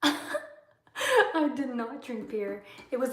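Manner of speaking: slow, amused protest with a laugh; laugh, then deny it emphatically; laughing denial. Real, not acted. Streamer answering a viewer about beer, laughing.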